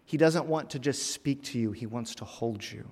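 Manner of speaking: usual speaking voice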